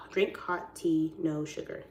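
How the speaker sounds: "Drink Hot Tea" slow, word by word; really slowly. Real, not acted. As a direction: repeat "Drink Hot Tea" at a measured pace. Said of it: "say your name, like, really slowly".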